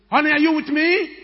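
a high, loud call across the distance; shouting. Retold or imitated. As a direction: yell to reach her in the commotion. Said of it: "I shout on the".

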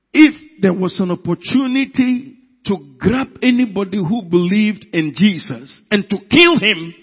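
loud, driving sermon delivery; fiery preaching. Acted. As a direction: preach it out with full force. Preacher building to full force.

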